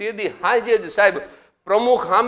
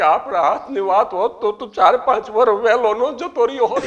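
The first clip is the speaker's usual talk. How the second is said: higher, louder wailing widow voice; mock weeping widow; 6 semitones higher, 4 dB louder than usual. Widow crying to the society president, hammed-up wail. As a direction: whine it like a tearful widow. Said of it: "રોતા રોતા મને કે".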